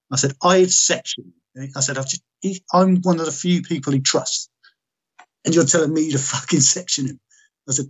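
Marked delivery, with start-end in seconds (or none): 0.4-1.3 high-pitched, indignant disbelief; retold incredulity. Retold disbelief, repeating the doctor's order. 2.0-7.2 high-pitched, aggrieved disbelief; retold incredulous protest. Retold protest against the doctor's order.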